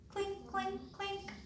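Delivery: high, soft, even clinks; imitating clinking spoons. A narrator's reading. Narrator hears soup spoons at lunch, imitating their clinking. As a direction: chime each word lightly on one high note. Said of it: "hear the soup spoons going".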